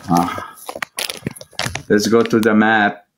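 usual speaking voice

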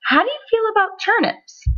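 high, coaxing mom voice; hopeful. A narrator's reading. Mom testing Sammy on turnips, hopefully. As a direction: float the idea with a high lilt.

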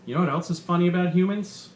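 lively, punchy comedian working the crowd; stand-up comedian. Acted. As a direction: sell the bit to a packed club. Comedian's stand-up set in story.

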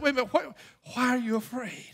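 suddenly soft, hushed question; gentle Jesus. Acted. Jesus calming frightened followers in the storm, gentle and quiet. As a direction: drop right down and ask it softly.

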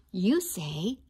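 normal talking voice

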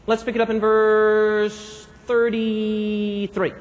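very slow, stretched words; low, lingering delivery; drawn-out. Real, not acted. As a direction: draw out the verse number while searching. Preacher stalls while finding the verse.

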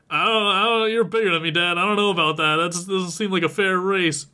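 lightly acted child hedging and objecting; hesitant protest. Son doubting a race against bigger dad, hesitant protest.

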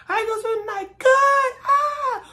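very high mock squealing "Good, ah!"; fan squeal. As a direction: scream back like a thrilled fan. Expected fan exchange, squeal.

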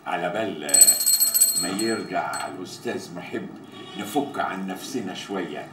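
very low and soft, with pauses; low, quiet request. Acted. Man waiting for the boss asks for a tune, low and quiet.

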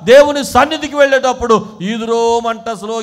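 loud, high, insistent preaching; preaching at full force. Real, not acted. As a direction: urge the congregation on forcefully.

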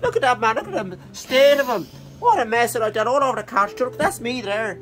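high, loud, cocky dog voice with lots of swearing; talking dog voice. Acted. Owner voicing his dog Pace on the couch: high, loud, cheeky dog voice. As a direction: play the scruffy dog who doesn't give a toss about the mess. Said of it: "he's always talking like that".

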